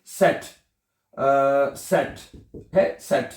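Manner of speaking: सेट with a TS sound; sharp सेट for Z; सेट, German for Z; teacher demo. Shown as a demonstration. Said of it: "Z को क्या कहते हैं".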